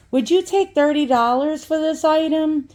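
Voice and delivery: high, sweet and polite asking. Retold or imitated. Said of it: "in my sweetest, uh, words".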